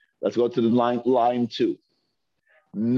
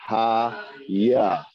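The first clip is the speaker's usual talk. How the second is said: usual speaking voice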